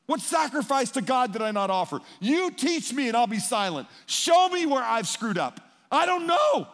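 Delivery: loud, high, rising desperate pleading; Job's anguished plea. Retold or imitated. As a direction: cry out to friends, demanding answers. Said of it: "He says".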